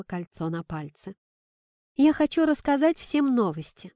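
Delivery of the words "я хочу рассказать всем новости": high, loud announcement; excited child's proclamation, pitched up; announcing. A narrator's reading. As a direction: address the whole table with big news. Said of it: "объявила Кира".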